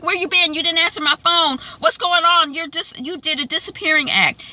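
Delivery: high, loud demanding questions; worried angry husband. Retold or imitated. Husband confronting his wife who vanished, worried and mad.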